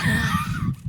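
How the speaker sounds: high-pitched burst of laughter. Real, not acted. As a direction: break into a high, loud laugh. Laughs after cursing the critics.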